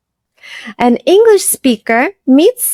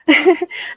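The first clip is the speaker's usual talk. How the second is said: a short amused laugh, pitched up; light laugh; 6 semitones higher, 3 dB quieter than usual. Real, not acted. Teacher laughing after acting out a stern tak. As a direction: laugh at her own stern demo. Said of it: "laugh after the demo".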